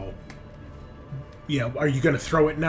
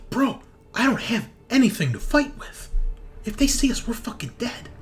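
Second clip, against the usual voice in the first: high-pitched, agitated pleading; high, alarmed voice of a man in trouble; panicked henchman; 9 semitones higher than usual. Acted. Game master voices panicking NPC.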